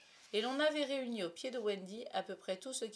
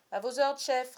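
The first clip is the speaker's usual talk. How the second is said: loud, higher-pitched acknowledgement; ringing yes-sir to a captain; eager obedience; 5 semitones higher, 13 dB louder than usual. A narrator's reading. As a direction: answer the chief like a soldier. Boy answers his captain's order.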